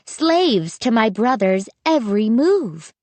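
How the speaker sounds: plain reading voice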